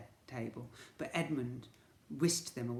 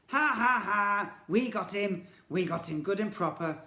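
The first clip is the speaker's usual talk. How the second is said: high, loud mocking ha ha; gloating villain laugh; 6 semitones higher, 10 dB louder than usual. Twins gloating after tricking the boy, loud mock laughter. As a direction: crow over the trick.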